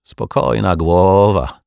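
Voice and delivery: slow, drawn-out sour remark; sour reassurance. A narrator's reading. Detective reassures with a sour smile, drawling sourly. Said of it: "uśmiechnął się kwaśno".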